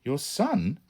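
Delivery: high, amazed question; astonished at the news. A narrator's reading. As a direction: Tom stunned to hear about a son.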